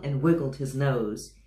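ordinary delivery, nothing marked

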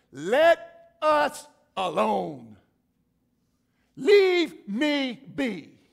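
slow, loud, strained demon cry; loud, drawn-out demon plea. Acted. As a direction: drag out each word like a tormented demon. Preacher voices the crying demon.

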